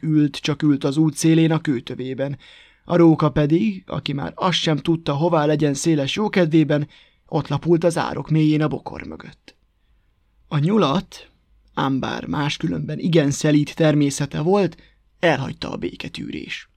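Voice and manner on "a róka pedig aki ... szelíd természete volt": plain reading voice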